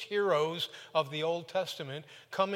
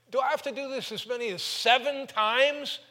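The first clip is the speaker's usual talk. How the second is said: higher and louder, pleading; whiny Peter; 8 semitones higher, 9 dB louder than usual. Retold or imitated. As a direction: whine it like a put-upon student. Peter asking Jesus about forgiveness.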